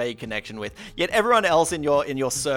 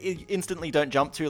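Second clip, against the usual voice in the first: ordinary talk, nothing marked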